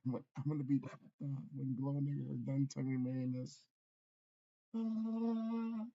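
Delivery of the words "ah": mock cry. Mock cry after rant.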